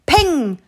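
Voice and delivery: a loud shouted peng; gunshot bang. A narrator's reading. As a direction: pop it out like a gunshot. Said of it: "Knallt es".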